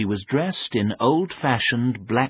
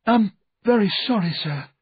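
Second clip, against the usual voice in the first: higher, quick apologetic character voice; meek, raised-pitch apology; quiet apology; 11 semitones higher, 3 dB louder, faster than usual. A narrator's reading. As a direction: apologise in a small high voice. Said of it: "he said quietly".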